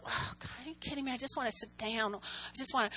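very quiet, private complaint; exasperated grumbling. Retold or imitated. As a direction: grumble under your breath to yourself. Acts her weary inner grumbling.

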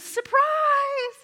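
very high, loud sing-song shout; spring it on him; gleeful surprise. Retold or imitated. Old college friend surprising him at the door. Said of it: "she goes".

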